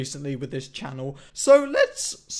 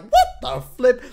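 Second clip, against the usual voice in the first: higher, louder retold shock; shocked reaction; 7 semitones higher, 8 dB louder than usual. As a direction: blurt it out in shock. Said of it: "my first reaction was like".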